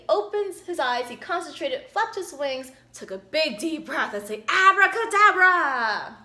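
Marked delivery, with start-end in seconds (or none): 4.5-6.2 high, loud, slow abracadabra; drawn-out triumphant spell; triumphant shout. Acted. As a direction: stretch the spell out big and loud.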